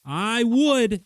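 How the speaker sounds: hammed-up shouted threat; mock threat. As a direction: yell the threat then drop it.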